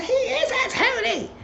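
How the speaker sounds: very high, squeaky mother's voice defending her son; high falsetto mother. Retold or imitated. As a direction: play the mom in a high falsetto. Woman character voiced in recap.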